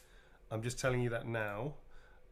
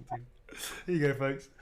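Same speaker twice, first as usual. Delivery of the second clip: normal talking voice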